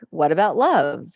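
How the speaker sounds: ordinary talk, nothing marked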